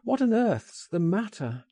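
high, baffled exclamation; crying out. A narrator's reading. Frank presses his shaking friend for an answer, crying out. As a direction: demand to know, voice lifted. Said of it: "I cried".

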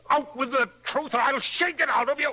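threatening demand. Acted. Husband demanding the truth.

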